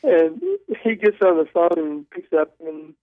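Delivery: ordinary talk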